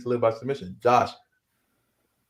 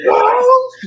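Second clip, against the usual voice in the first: loud, high-pitched mock "oh!"; mock cry; 14 dB louder than usual. Retelling his reaction to the wrestling, mock cry. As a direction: imitate a cry, shooting way up high. Imitating a startled reaction in story.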